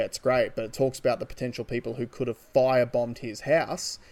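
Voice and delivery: ordinary delivery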